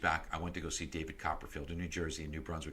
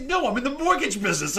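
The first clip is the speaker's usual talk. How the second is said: mock answer; 14 dB louder than usual. Retold answer to magician.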